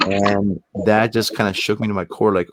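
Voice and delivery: usual speaking voice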